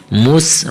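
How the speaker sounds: مس with the س hissed like a whistle; whistled seen. Shown as a demonstration. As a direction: teeth close, whistle the س in مس. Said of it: "مس سیٹی کے ساتھ".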